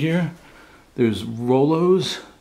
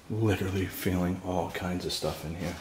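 scared hush; 5 semitones lower, 9 dB quieter than usual. Real, not acted. Frightened confiding to viewers.

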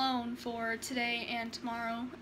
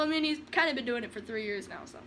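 usual speaking voice

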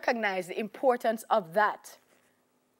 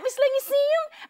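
high, loud eager call to the neighbor; a seven-year-old bursting to share; excited child; 6 dB louder than usual. Retold or imitated.

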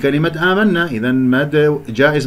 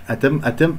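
usual speaking voice

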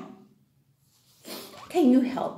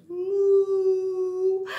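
one long, raised moo; cow mooing imitation; 5 semitones higher than usual. Teacher showing children what a cow says, long moo. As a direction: draw out a cow's moo. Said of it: "and the cow goes".

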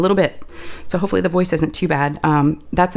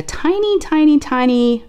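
high, squeaky-small "tiny, tiny, tiny"; drawn-out high little voice; 9 semitones higher, slower than usual. Real, not acted. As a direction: pitch it way up to show how tiny. Stressing how small the cutter is.

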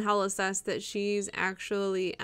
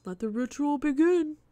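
higher, louder ritual shout; roaring yell; 6 semitones higher, 7 dB louder than usual. A narrator's reading. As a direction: roar it like a cultist. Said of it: "with a guttural roar yells".

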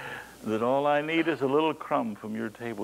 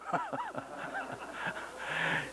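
high laugh; 10 dB quieter than usual. Real, not acted. Laughs after imagined reply.

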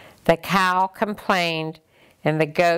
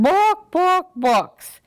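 higher hen clucks; clucked book; 12 semitones higher, 4 dB louder, slower than usual. Acted. The barnyard animals delight in their books, clucking.

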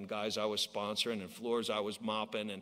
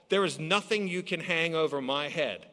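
usual speaking voice

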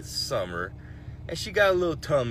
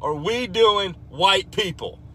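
a high, loud exasperated call-out; comic rant; 7 semitones higher, 7 dB louder, slower than usual. Acted. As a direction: scold them loudly.

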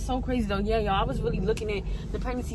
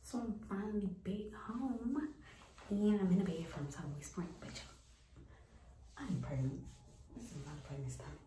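whispering on the phone; 14 dB quieter than usual. Real, not acted. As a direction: keep it hushed, someone's asleep. Talking quietly in bed at home.

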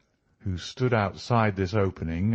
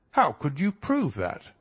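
higher-pitched inspector's question; inquiring inspector; 7 semitones higher than usual. A narrator's reading. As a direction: ask it eagerly, pitch lifted. Inspector questions Holmes in story.

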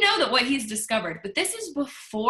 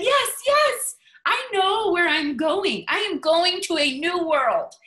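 loud, high, slow triumphant cry; cried out; 7 semitones higher, 6 dB louder, slower than usual. A narrator's reading. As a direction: proclaim it to the western sky, slowly. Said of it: "he cried".

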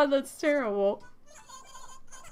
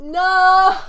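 much louder, higher cry of dismay; anguished shout; 8 semitones higher, 11 dB louder than usual. Real, not acted. Player yelling at the game over bad luck, loud despair. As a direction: yell it out in despair. Reacts to reward.

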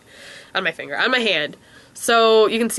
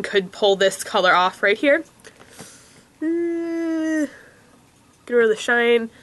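normal talking voice

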